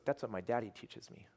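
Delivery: a retold whisper, breathy and secretive; hushed child's words. Retelling to the team what the girl whispered, hushed and eerie.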